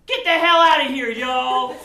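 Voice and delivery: shouted send-off, hammy; loud group send-off. Shown as a demonstration. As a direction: yell them off stage. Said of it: "give them a big".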